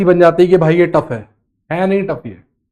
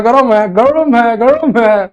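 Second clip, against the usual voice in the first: mock complaint; 4 semitones higher, 4 dB louder, much slower than usual. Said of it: "कह रहे हो".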